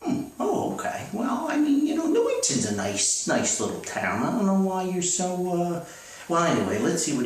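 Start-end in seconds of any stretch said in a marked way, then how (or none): none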